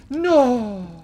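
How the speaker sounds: loud, high drawn-out no; a long, loud wail of protest; dismayed cry. Real, not acted. Sudden dismay during game play.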